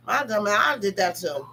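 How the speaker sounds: ordinary talk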